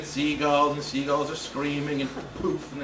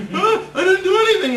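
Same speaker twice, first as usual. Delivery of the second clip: much higher and louder frantic denial; panicked shout; 13 semitones higher, 8 dB louder than usual. Retold or imitated. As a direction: shout your innocence in panic.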